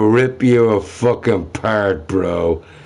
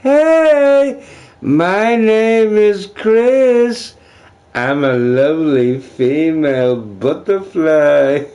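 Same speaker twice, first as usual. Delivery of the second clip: very high, slow squeaky chipmunk; 8 semitones higher, 4 dB louder, slower than usual. Retold or imitated. Streamer mocking a troll as a very high squeaky chipmunk. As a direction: stretch it out like a cartoon critter. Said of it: "there you go".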